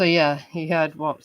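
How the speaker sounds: ordinary delivery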